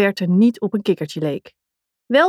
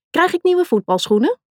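excited asking; 9 semitones higher than usual. Acted. Excited girl asks for present.

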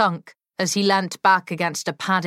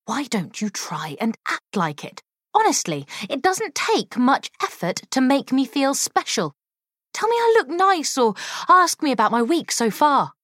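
sharp-tongued woman; 8 semitones higher than usual. Acted. Molly speaks, raised and pointed.

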